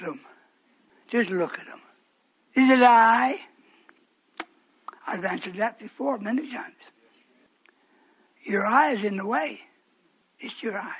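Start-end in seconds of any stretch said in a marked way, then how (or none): 5.1-6.8 soft unvoiced aside; whispered aside. Real, not acted. Preacher whispering aside to the church. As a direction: drop to a whisper.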